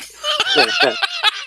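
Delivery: loud high cackling laugh; burst of laughter. Real, not acted. Laughing at trolling Reddit fans, loud cackle. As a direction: cracks up laughing at the prank.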